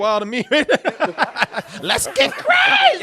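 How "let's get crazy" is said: slow, much higher and louder whoop; stretched-out gleeful shout; laughing shout. Real, not acted. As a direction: let it rip with a laughing yell. Hyping the pig chaos.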